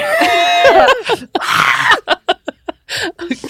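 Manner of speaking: high, loud shrieking cackle; shrieking laugh. Real, not acted. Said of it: "konstigt läte".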